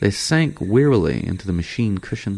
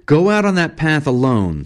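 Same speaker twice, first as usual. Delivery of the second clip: raised, higher voice of command; stern, heated banishment; angry order; 9 semitones higher, 5 dB louder than usual. Acted. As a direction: order him out, loud and high. Angry guide banishes Eccles at gunpoint.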